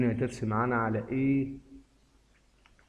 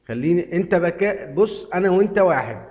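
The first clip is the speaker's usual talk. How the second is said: raised, louder excited appeal; 5 semitones higher, 6 dB louder than usual. Real, not acted. Gamer hyped to viewer, louder excited appeal. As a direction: lean in and push the point, pumped up.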